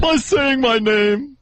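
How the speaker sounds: high, drawn-out mock announcer answer; [name]. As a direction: butt in slowly with her put-on voice. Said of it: "read how she relaxes".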